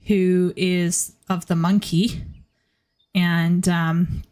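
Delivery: ordinary talk, nothing marked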